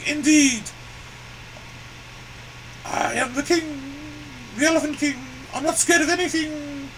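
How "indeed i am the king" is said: ordinary talk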